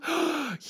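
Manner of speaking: high sharp pretend gasp; theatrical gasp; mock shocked gasp. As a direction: gasp as if scandalised.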